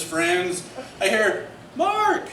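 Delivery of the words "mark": high call of the name. Retold or imitated. Sister calling him on the beach, high call. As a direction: shout across the beach.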